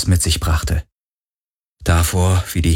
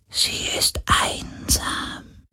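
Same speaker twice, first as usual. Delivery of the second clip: flüsternd; 9 dB quieter, much slower than usual. A narrator's reading. Geist des Meeres flüstert.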